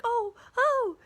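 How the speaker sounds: high, loud, sharp alarm cries; retold alarm watching cars near the phone; alarmed gasps. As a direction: yelp as the tyres just miss it. Cars nearly hit the phone.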